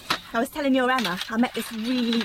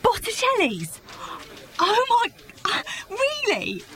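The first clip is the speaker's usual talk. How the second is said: higher, delighted gasps of surprise; surprised; 12 semitones higher, slower than usual. Acted. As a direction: squeal it, amazed and thrilled. Thrilled by restaurant surprise.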